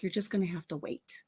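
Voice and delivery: lightly low, soft reminder; low patient refrain. Retold or imitated. Grandmother telling granddaughter to wait, low and patient. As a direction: say it softly, dropping in pitch.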